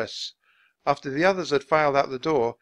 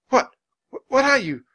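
high, loud stammer of disbelief; sputter, tripping on the first words; shocked sputtering; 11 semitones higher, 9 dB louder than usual. A narrator's reading. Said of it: "Nancy sputtered".

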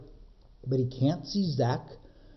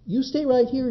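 telling goats to stay; 9 semitones higher, 4 dB louder than usual. Acted. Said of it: "he tells the other goats".

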